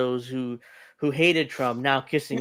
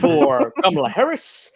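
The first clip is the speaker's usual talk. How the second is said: presidential impression, pitched up and louder; Barack Obama; 11 semitones higher, 5 dB louder than usual. Host urging a vote as Obama, raised presidential impression. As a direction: lift it high like a speechmaking politician. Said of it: "that's my best Obama impression".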